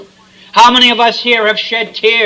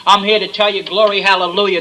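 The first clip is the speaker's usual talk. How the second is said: normal talking voice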